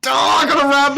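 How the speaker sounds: high, loud playful alarm; mock panic. Host jokingly flees the dom countdown, mock panic. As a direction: burst out in pretend panic.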